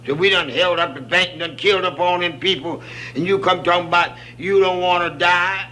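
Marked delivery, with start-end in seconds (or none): none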